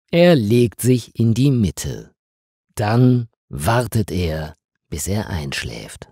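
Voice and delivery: ordinary delivery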